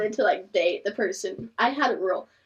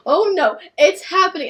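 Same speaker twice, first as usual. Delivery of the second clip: mock panic; 7 semitones higher, 8 dB louder than usual. Panicking about proposal.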